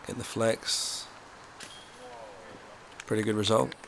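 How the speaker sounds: ordinary talk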